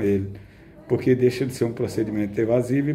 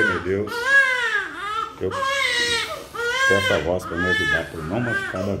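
prayer. Real, not acted. Prays aloud during blood draw.

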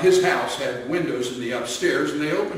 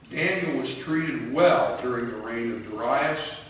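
ordinary delivery

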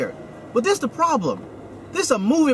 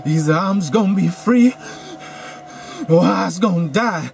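mock winded slave voice; 3 semitones lower than usual. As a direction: ham up a panting movie line. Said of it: "winded all the time".

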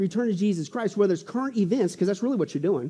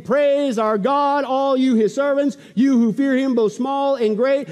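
slow, stretched proclamation; voice from the throne; 5 semitones higher, 5 dB louder, slower than usual. Acted. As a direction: command praise in preacher's cadence. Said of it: "a voice came from the throne".

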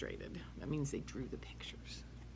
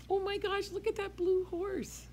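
much higher, excited gasp of wonder; mock amazement; 4 dB louder than usual. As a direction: act amazed for the kids.

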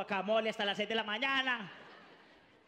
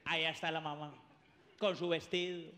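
ordinary talk

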